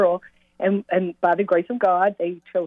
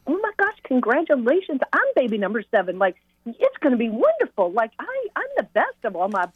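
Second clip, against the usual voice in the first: bright, much higher, eager gushing to a worried mother; excited congratulations; 6 semitones higher than usual. Retold or imitated. Retelling how she cheered up the mother of baby seven, high and excited. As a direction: gush with delight to win her over.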